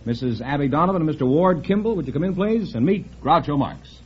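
ordinary talk, nothing marked

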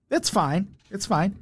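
normal talking voice

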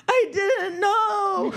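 slow, high, crying I didn't know; a kid blubbering his excuse; tearful excuse. Retold or imitated. Son explaining to parents, slowly through tears. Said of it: "burst into tears".

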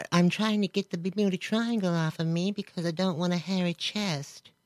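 high, soft mocking imitation; mimicking the guest. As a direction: lightly mimic a confused woman. Mimics interviewee's strange quote.

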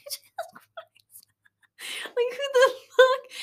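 high whisper breaking into a crying laugh; whispered then squeaked out teary; mock sob-laugh; laughing through tears. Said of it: "I was like".

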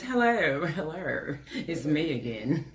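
lightly put-on work phone voice. Shown as a demonstration. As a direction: greet like you're on the job. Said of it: "y'all got a work voice".

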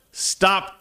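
high, mock yell at normal volume; retold yell. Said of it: "I just yelled".

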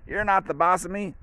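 high, bratty "you're not the boss of me" in a put-on voice; childish defiance. France sulking back at the European Union, high and bratty. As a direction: talk back like a sulky kid.

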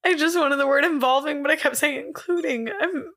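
high, cracking up near tears; real giggly near-crying voice; about to cry. Real, not acted. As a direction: whine it helplessly through tearful laughter. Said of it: "I'm about to cry".

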